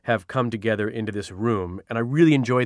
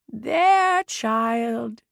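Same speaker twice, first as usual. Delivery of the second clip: soothing murmur; 12 semitones higher than usual. A narrator's reading. Eliana with hand on Ruby's forehead, soothing murmur. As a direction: hush a child gently. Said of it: "Eliana murmured".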